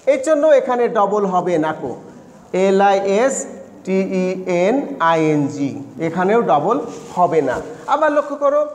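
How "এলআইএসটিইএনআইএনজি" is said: slow, lower letter-by-letter spelling; spelling aloud slowly. Shown as a demonstration. Teacher spelling listening slowly for students. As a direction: spell it out for the class.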